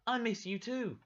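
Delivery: high, put-on child's voice; a little boy telling Marta he misses her.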